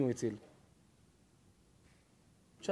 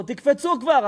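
urging shout; 8 semitones higher, 5 dB louder than usual. Acted. American urges lifeguards to jump.

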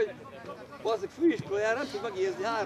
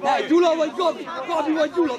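loud, raised demand; shouted question; 3 semitones higher, 8 dB louder, faster than usual. Real, not acted. Coach asking Gyula or Gabi, shouting. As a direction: shout the choice at both players.

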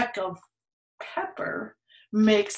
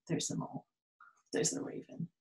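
hushed pointing out; whisper; 4 semitones lower, 17 dB quieter, much faster than usual. A narrator's reading. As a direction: whisper it like a secret. Pointing out animals to child.

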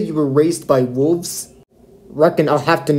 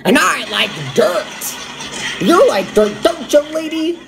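mocking voice; 12 semitones higher than usual. Diner mocks Kimmy's dirt remark.

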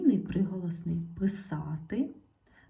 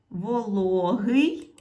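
very slow, loud "Вологий"; slow loud drill word; drawn-out check word; 6 dB louder, much slower than usual. Shown as a demonstration. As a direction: stretch "Вологий" out slowly and loudly. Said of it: "Перевіряємо".

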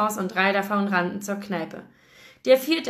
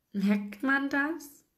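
small pained smile, softer and drawn out; 5 semitones higher, 4 dB quieter, much slower than usual. A narrator's reading. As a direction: ask slowly with a forced, sheepish smile. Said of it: "Lächelte ich gequält".

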